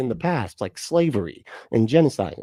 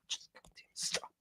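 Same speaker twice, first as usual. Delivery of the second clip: ordinary talk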